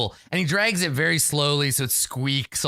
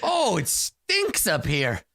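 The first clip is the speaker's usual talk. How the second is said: appalled sorcerer; 7 semitones higher than usual. Acted. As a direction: wail about the stench in a high voice. Sorcerer recoils from the stench.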